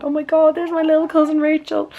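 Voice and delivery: high, excited retold squeal of pride; re-enacting a thrilled fan in the crowd; proud excitement. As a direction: gush it high, like spotting family on stage. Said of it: "I was, like, in the crowd going".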